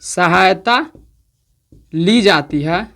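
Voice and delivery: word by word, with long pauses while writing; slow dictation. Shown as a demonstration. Teacher dictating the definition to students, slow and halting. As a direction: dictate slowly so students can copy. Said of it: "तो लिख देते हैं definition आपका".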